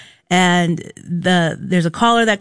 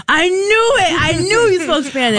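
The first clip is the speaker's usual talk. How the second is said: imitating him, much higher and gleeful; gloating "I knew it", pitched way up; triumphant; 10 semitones higher than usual. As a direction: play the contractor crowing that he was right. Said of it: "And he's like".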